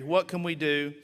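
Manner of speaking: normal talking voice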